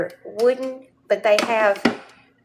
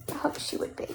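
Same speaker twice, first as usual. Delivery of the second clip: breathy whispered aside, higher and softer; whispered correction; 5 semitones higher, 11 dB quieter, faster than usual. Real, not acted. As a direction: whisper the fix to yourself. Whispered correction of previous line.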